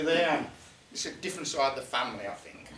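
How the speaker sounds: usual speaking voice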